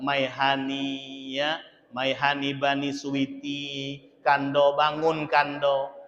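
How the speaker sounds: slow, soft, low pet-name coaxing; sweet and gentle. Retold or imitated. Wife cooing pet names to wake husband, slow. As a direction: coo him awake with pet names. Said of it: "Dengan lemah lembut".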